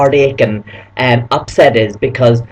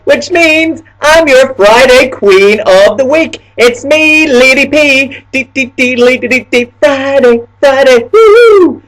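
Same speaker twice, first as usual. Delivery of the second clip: higher, louder, slowed showbiz announcing; hammed-up hostess; 11 semitones higher, 5 dB louder, slower than usual. Introducing herself to viewers as Friday Queen, hammed-up. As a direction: present yourself like a pageant queen.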